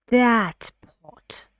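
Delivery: "that" stretched slow and loud before "pot"; stress on that. Shown as a demonstration. Teacher warning which pot matters, heavy stress on that. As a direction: draw out "that" with heavy emphasis. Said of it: "Here I give more emphasis to this word".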